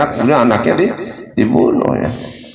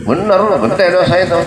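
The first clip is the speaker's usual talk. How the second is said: pitched way up in disbelief; amazed exclamation; 10 semitones higher than usual. Real, not acted. Preacher to the congregation, exclaiming in amazement.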